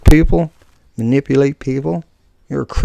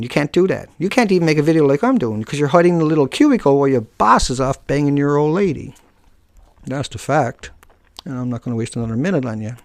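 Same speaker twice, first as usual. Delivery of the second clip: normal talking voice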